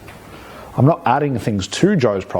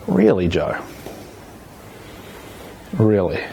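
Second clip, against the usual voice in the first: "Really, Joe? Really?" with both "Really" falling and a long pause between; implied doubt; 2 semitones lower than usual. Shown as a demonstration. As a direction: let "Really" fall and hang in doubt. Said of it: "I'm not saying much, but my implication is".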